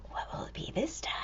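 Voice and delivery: quick hushed whisper. Acted. Reader to children, quick whisper. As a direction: build the suspense softly.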